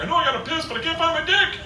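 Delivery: put-on high-pitched drunken bewilderment; confused drunk. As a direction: whine it like a helpless drunk. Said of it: "You ever been so drunk".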